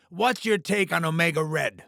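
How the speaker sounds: ordinary delivery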